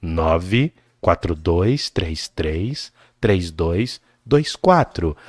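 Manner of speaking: low, slow ad read; slow late night radio DJ voice; radio announcer voice. Shown as a demonstration.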